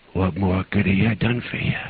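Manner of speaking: subdued, pleading question, spent; mild retold sobbing, voice drained of force; understated sob. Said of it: "began to sob".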